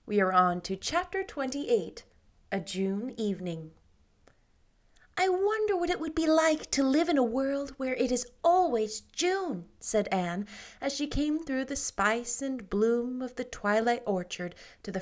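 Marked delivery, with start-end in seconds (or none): 5.2-9.7 put-on light, higher girlish voice, dreamy; Anne's voice. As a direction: lift the voice a little for young Anne. Anne speaks, wistful.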